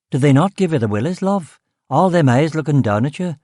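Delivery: lightly put-on local Belfast speech, quick; chummy Belfast street talk; Belfast demotic. Detective chatting up the cook, in broad Belfast demotic. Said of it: "I said in the demotic".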